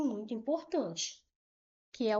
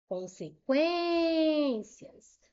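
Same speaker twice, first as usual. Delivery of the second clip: Consequências drawn out slowly, the syllable quên lifted high; syllable stress demo; 5 semitones higher, 3 dB louder, much slower than usual. Shown as a demonstration. Teacher to students, stretching the word to show its stress. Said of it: "qual é a sílaba tônica".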